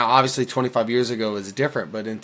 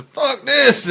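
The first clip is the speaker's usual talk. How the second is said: much higher, loud mock shout; imitated yell; 14 semitones higher, 7 dB louder than usual. Host echoing the friend's soldier quote, mock yelling high and loud. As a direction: echo the outburst in a high, playful yell. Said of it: "he's the one that yells".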